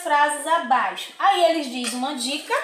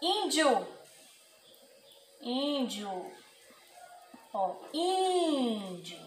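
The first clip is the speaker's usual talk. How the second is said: Índio, first syllable í stressed, fading softer; 7 dB quieter, much slower than usual. Shown as a demonstration. Teacher shows accent marks the strong syllable: stressed í. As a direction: lean on the í of Índio. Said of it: "ele muda o som da vogal".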